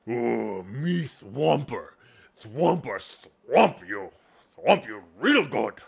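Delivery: loud, slow, brutish ogre. Shown as a demonstration. Game master voices an ogre threatening players, loud and slow. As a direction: boom it out like a dim ogre. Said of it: "you have your two ogres".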